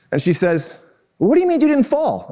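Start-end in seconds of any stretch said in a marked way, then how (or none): 1.2-2.3 high-pitched imitated disbelief; incredulous. Wife overhearing his phone boast, imitated high incredulity. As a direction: challenge it in a high, indignant retelling. Wife challenges him in retold argument.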